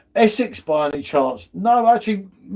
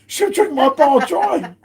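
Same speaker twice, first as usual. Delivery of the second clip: high, drawn-out mock outcry; mock protesting Bazza; 8 semitones higher, 4 dB louder, slower than usual. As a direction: imitate an indignant complaint, slow and high. Acting out Bazza's objection.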